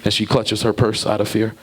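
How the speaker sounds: usual speaking voice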